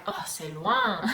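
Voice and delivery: slow, weary c'est loin; drawn-out French sigh of a phrase; lightly mocked French reluctance; French complaint. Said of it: "constant French thing that I heard".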